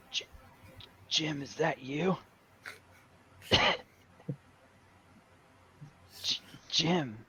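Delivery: faint, halting voice broken by coughs; dying friend. Pretend. Player haunting Jim as his dead friend, faint and halting. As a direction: stammer softly as a fading ghost. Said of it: "pretending to be his dead friends".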